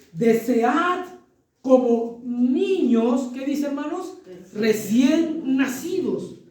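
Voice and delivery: slow, emphatic Bible reading; solemn scripture reading. Acted. Preacher reading First Peter, slow and solemn. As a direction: read the verse slowly, weighing each word.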